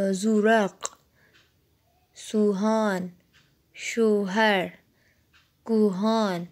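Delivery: each Persian word said slowly with a clear rounded o; Persian o vowel. Shown as a demonstration. Persian teacher sounding out vav words for students, clear o. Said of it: "الف واو پیش او".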